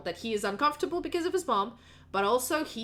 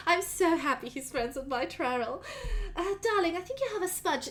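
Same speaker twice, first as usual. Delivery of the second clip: doting, chatty mother; 6 semitones higher than usual. Acted. Mother to her son's friends, fondly gushing. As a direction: fuss fondly in a high mother's voice.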